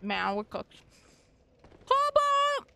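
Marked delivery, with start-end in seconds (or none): 1.9-2.7 loud, high shout of the name; calling out. Real, not acted. Player shouting at another player, loud high call. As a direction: yell it across the game world. Calls out in game.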